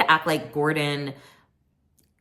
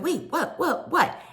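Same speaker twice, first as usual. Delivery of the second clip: much higher baffled repeats; cast confusion; 12 semitones higher than usual. Retold or imitated. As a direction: sputter in confusion. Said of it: "everyone was like".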